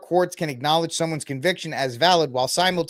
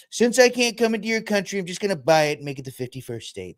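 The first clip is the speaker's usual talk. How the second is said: lightly voiced, higher brag that drops down; mock Trump boast; 5 semitones higher than usual. Mocking Trump telling Canada he'll buy it. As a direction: play the swaggering buyer of a whole country. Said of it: "And he's like".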